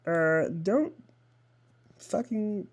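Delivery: high, strained voice after a cut; pained. Real, not acted. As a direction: squeeze it out through pain. Pained tip after cutting finger.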